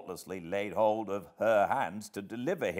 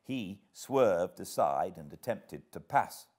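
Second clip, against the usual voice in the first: usual speaking voice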